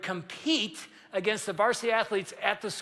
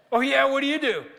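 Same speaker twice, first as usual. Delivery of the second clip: mock challenge; 5 semitones higher, 6 dB louder than usual. Retold kid challenging the kicker.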